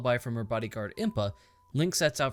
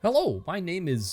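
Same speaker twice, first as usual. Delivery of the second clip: hammed-up introduction, higher and slow; Tim Allen; 5 semitones higher, 4 dB louder, slower than usual. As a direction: introduce yourself in a mock comedian voice, drawn out. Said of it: "Tim Allen mutter under his breath".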